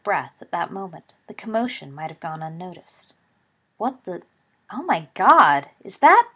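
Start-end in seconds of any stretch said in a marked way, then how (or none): 3.8-6.3 rising alarmed outburst, louder and higher; startled, building to a loud high cry; shocked cry. A narrator's reading. Slytherin spectator crying out at something under the stands, shocked. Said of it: "The cry went up".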